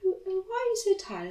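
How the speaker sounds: high, quick receptionist voice. Retold or imitated. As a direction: flutter it out, squeaky and hurried. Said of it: "she looked at me and she was like".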